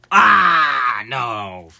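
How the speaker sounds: anguished cry. Real, not acted. Machine fails again.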